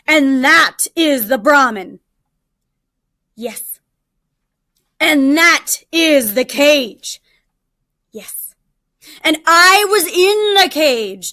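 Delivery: impatient tiger. Acted. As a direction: hammer each point home, fed up. Tiger explaining step by step.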